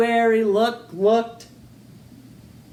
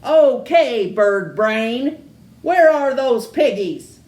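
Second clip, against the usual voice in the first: bossy witch demand; 4 semitones higher, 6 dB louder than usual. Acted. Witch bullies chicken.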